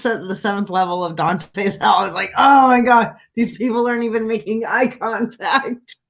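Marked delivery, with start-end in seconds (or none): 2.4-6.0 exasperated outburst. Retold or imitated. Retelling misery of barking for tickets.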